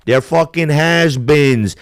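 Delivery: ordinary delivery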